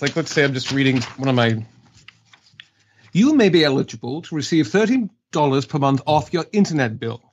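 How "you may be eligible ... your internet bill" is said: English-accented sample read. Shown as a demonstration. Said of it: "demonstrate, uh, your English accent for us".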